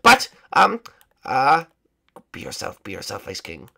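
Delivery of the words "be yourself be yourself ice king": low, soft mutter to himself; hushed private pep talk; muttered self-coaching. Acted. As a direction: coach yourself under your breath.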